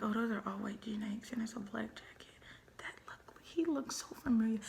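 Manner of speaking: whispered retold inner thought; hushed recognition. Storyteller recognizing him by his clothes, hushed recognition. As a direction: whisper it as if piecing it together. Acts out her dawning recognition.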